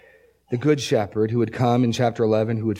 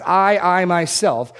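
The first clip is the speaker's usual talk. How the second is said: I, I myself said slowly, higher and louder on I; emphasis on I; 4 semitones higher, 4 dB louder, slower than usual. Shown as a demonstration. Preacher points out God's emphasis, slow and raised. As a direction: hammer the I, I myself. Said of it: "notice that emphasis".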